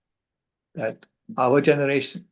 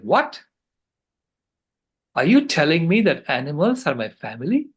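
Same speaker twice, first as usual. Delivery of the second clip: put-on child's surprise; 4 semitones higher, 3 dB louder than usual. Granddaughter reacts in disbelief.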